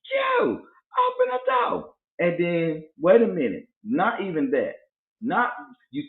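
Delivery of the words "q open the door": high, drawn-out imitation of a caller; mock wailing yell. Neighbor banging at his door again, mock wailing yell. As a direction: holler slowly in a piercing high pitch.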